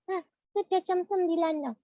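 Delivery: put-on squeaky child puppet voice, confused; high puppet voice. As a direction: play the little puppet mishearing. Puppet voice of Mulia.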